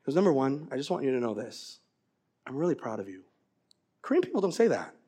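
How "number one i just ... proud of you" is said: low, soft voice of a reticent dad; tender fatherly confession. Retold or imitated. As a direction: confide it quietly, as a father to his son. Voices dad telling son he's proud.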